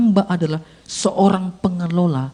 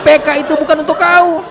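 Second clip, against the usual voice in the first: high, loud repeated decree; inner voice's verdict; 10 semitones higher, 8 dB louder than usual. Acted. Inner voice telling preacher the envelope isn't his, high and loud. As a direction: call it out high over the laughter. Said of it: "suara itu muncul lagi".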